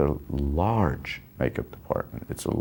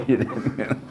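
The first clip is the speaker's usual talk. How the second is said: laughing mock quote; 7 semitones higher than usual. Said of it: "laughs he said".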